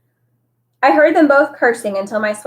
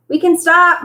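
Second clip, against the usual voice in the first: higher, louder call from ahead; yell; 8 semitones higher, 5 dB louder than usual. A narrator's reading. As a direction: call back to the others. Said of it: "Miles yelled from farther up".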